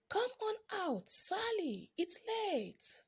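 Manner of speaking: high call into the dark; calling out again. A narrator's reading. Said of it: "They yelled again".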